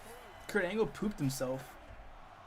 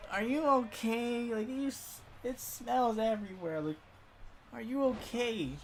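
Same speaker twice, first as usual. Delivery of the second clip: put-on older southern woman; 8 semitones higher than usual. Older teacher checks on Kurt Angle, concerned southern accent. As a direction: fuss over him in a put-on southern accent. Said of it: "a southern accent".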